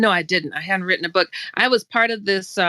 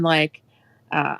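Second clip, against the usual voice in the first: ordinary delivery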